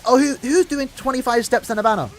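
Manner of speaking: lightly mocking a moaning player; mocking complainer. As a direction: sneer it like a complaining fan. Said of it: "people complained".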